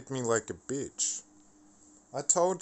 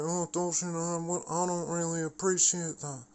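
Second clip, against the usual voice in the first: higher, prim mimic of her reply; prissy mock complaint; 6 semitones higher than usual. Mocking CPE's offended reply to his message, high and prissy.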